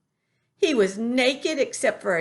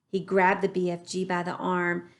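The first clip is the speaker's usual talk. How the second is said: plain reading voice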